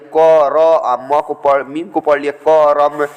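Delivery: क र आ म spelled out slowly, then करम as ka-ram; wrong split reading. Shown as a demonstration. Teacher demonstrates the wrong way to students, slow spelling. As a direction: spell out each letter slowly, then say करम as two syllables. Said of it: "तो ये गलत हो जाएगा".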